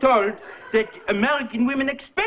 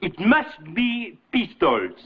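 put-on French accent; 5 semitones lower than usual. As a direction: dismiss it, very French. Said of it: "Qu'est-ce que c'est".

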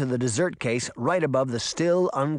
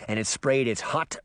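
ordinary delivery

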